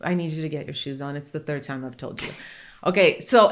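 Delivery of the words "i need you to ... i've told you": soft, even instruction as if through a megaphone; mild mock megaphone announcement; calm quiet megaphone voice. Shown as a demonstration. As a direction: say it calmly, no yelling. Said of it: "Just, you know".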